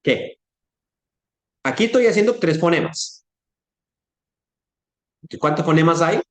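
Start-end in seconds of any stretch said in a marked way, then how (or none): 0.0-0.4 "qué" as the T syllable with tongue on the palate; "qué" standing for a T off the palate; palatal T. Shown as a demonstration. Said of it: "con el paladar de la boca".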